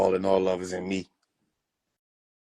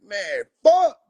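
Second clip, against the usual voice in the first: much higher, louder fed-up curse; exasperated Shroom; 5 dB louder than usual. Acted. Shroom fed up when rapper pops shrooms, high voice. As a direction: groan it as a worn-out mushroom. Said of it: "Shroom like".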